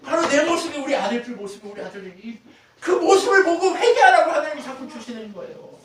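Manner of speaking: high, loud pulpit cry; impassioned shouted exhortation; forceful preaching. Real, not acted. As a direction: raise the voice to urge repentance. Preacher drives home call to repent.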